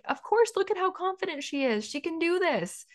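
high, sure-voiced cheer for her; supporters' voice. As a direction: voice the confident believers around her. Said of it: "They're like".

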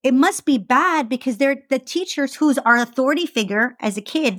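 ordinary talk

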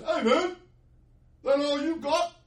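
put-on high, loud old-man holler; old geezer. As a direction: call out as an old geezer from the back. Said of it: "this old geezer guy".

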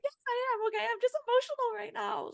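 high squeaky voice, playing her teary self; mock teary self. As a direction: squeak it out like she's caught crying. Retelling herself being caught crying.

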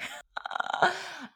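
squealing self-amused laugh; high burst of laughter. Real, not acted. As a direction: crack up at yourself. Laughs at her own wish.

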